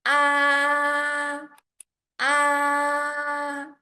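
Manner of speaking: "Ah" held high and level; long flat high note on "Ah"; first tone. Shown as a demonstration. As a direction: sustain "Ah" loud on one high, steady pitch. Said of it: "it starts high and maintain high".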